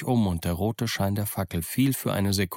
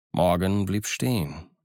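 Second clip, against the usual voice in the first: usual speaking voice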